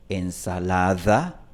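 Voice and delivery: ensalada with a clear fricative d, slow; ensalada, rub the d against the teeth. Shown as a demonstration. Teacher demonstrating the fricative d in ensalada. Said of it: "varía entre una fricativa".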